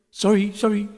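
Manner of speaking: put-on high, small child's voice; a scared little girl apologising; child's voice murmuring sorry in fright; frightened murmur. A narrator's reading. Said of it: "Dolly murmured in fright".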